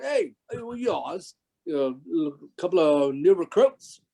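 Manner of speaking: put-on Cockney accent. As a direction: imitate a Cockney crew member sizing up newcomers. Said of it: "more of a Cockney accent".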